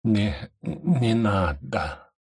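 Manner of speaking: very slow, rasping plea; slow, wheezing protest; hoarse rasp. Acted. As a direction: croak it out, barely able. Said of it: "прохрипел Нилан".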